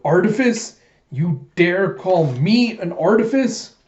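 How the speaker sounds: high, loud, rising disbelief; indignant outrage. Acted. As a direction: throw the insult back as an outraged question. Den enraged at being called artifice.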